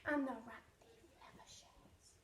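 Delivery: menacing whispered boast. Acted. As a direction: drop to a sly whisper. Rat's threat drops to whisper.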